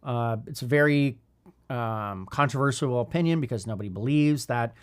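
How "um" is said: ordinary talk, nothing marked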